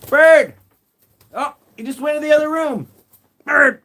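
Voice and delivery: loud high call; loud high-pitched shout to a pet. Real, not acted. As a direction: call after the bird across the house. Calling bird that fled room.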